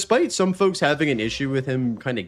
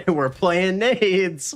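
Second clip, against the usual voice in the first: laughing; 8 semitones higher, much slower than usual. Real, not acted. Repeats line laughing.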